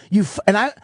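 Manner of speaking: ordinary talk, nothing marked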